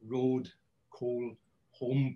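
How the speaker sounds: ROAD, COAL, HOME with long O vowels and little glide; Welsh English vowels. Shown as a demonstration. Linguist recalling earlier examples, ROAD, COAL, HOME in Welsh pronunciation. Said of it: "that's what's happened with these".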